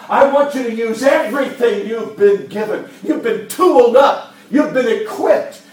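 loud, high, fervent exhortation; full-force preaching. Acted. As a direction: preach it out with fire.

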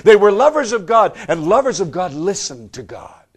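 fired-up preacher; impassioned preaching. Acted. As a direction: drive it home to the congregation at full voice. Preaches at full force.

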